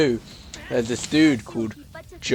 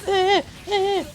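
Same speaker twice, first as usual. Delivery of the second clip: very high squeaked "eh, eh"; mock angry squabbling noise. Co-host imitating two whiny rivals, high-pitched mock angry squeaks. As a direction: imitate whiny boys bickering in tiny squeals. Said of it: "angry at each other".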